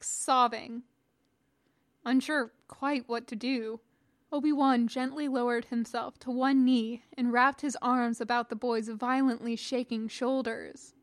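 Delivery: ordinary delivery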